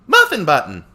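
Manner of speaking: abridged Goku. Acted. Streamer trying a parody hero line, high loud Goku voice. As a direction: pitch it up and call it out. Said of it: "try to do the abridged Goku".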